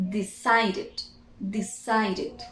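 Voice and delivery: "decided" said carefully, ending in a short "id"; "decided" in three clear syllables; pronounced -ed ending. Shown as a demonstration. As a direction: model "decided" twice, sounding the last syllable. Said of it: "pronounce it".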